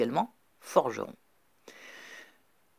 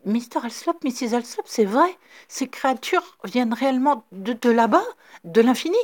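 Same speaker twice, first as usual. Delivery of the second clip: excited questioning; 8 semitones higher, 7 dB louder than usual. A narrator's reading. Rafferty excitedly questions the Alsops.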